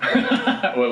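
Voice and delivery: laughing through the words; half-laughing question. Real, not acted. GM laughing at player's line.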